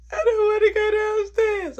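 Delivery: high, loud tearful whine; scared. Retold or imitated. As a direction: wail it in mock terror, crying. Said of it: "I'm so scared".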